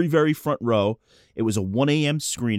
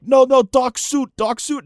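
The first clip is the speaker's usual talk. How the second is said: high, slow, loud imitated Japanese accent; struggling English in a Japanese accent; 11 semitones higher, 6 dB louder than usual. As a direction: insist in a mock Japanese accent. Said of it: "like, accent, can barely speak English".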